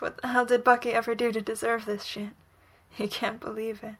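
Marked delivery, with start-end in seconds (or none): none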